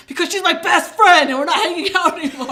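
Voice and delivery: loud, high, rushed protest; exasperated outburst. Retold or imitated. Teen defending her friendship, loudly and fast. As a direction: blurt it out like a wronged best friend. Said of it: "And I'm like".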